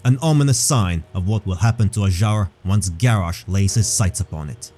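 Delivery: normal talking voice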